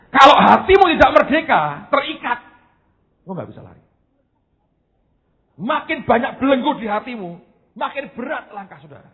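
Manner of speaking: high, intense preaching voice; impassioned sermon delivery. Acted. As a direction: preach it with fervour, rising. Preaching at full force.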